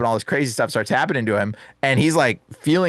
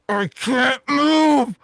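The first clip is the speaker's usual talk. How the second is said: high, drawn-out strained imitation of a frozen man; mouth barely moving; 10 semitones higher, much slower than usual. Frozen man answering the one praying, barely mouthed. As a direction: force it slowly out the side of your mouth. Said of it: "says it barely out the side of his mouth".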